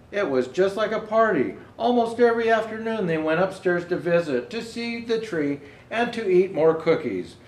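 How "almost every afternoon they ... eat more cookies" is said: ordinary delivery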